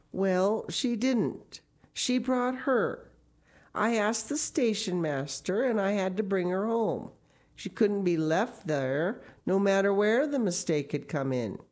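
patient, slightly awkward explanation; lightly voiced Matthew. A narrator's reading.

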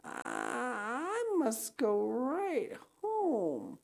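very slow, high, dragged-out words; thick, sickly voice. Acted. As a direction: stretch it out woozily, stumbling on the start. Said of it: "she said a little thickly".

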